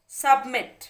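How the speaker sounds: Submit loud, weight on मिट; emphatic demo of submit, back-stressed; stress on last syllable. Shown as a demonstration. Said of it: "बोलते वक्त stress आ रहा है".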